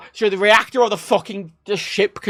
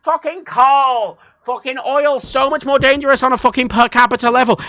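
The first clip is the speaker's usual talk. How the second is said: exasperated retort; 6 semitones higher, 6 dB louder than usual. Real, not acted. Streamer rebutting nuclear sceptics, loud exasperation. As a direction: hit back in disbelief, pitched up.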